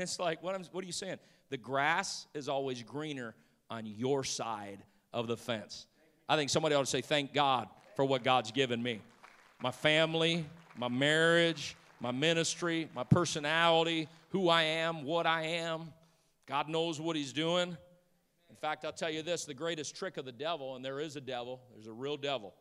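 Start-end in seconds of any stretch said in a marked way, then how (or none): 6.3-17.8 louder preaching cadence, line by line. Acted. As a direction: preach it out with conviction. Preaching gratitude at full force.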